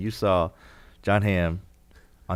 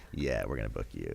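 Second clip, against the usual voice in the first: quiet, matter-of-fact; 12 semitones lower, 11 dB quieter than usual. Retold or imitated. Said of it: "He was just like".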